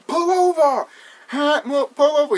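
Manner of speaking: high-pitched imitation of calling to a driver; hammed-up urgent call; mock calling out. Imitating a shout to pull over, mock calling out. Acted shout to stop the truck.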